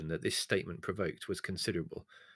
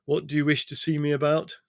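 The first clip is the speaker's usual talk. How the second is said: curt demand; 6 semitones higher, 8 dB louder than usual. Acted. Leslie confronting the man.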